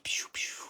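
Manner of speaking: soft whispered laser pew pew; play-zap the project past quietly; pew pew sound effect. Acted. Flying the projects by for viewers with whispered pew sounds. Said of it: "I'm making sound effects".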